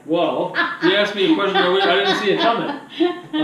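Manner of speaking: laughing through the words. Real, not acted. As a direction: laugh while answering, caught off guard. Caught off guard by question.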